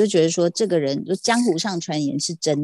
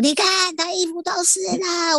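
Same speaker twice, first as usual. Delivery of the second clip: exaggerated high-pitched nag, lightly retold; imitated nagging husband; 11 semitones higher than usual. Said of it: "被我老公又狂念说".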